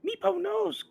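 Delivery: very high, loud squeaky creature voice; a sad little kobold fondly remembering his pet; Meepo describing the dragon. Acted. Meepo describing his lost wormling Calcrix, high mournful voice. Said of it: "and he describes it".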